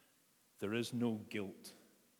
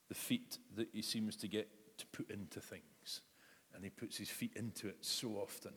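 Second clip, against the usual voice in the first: ordinary talk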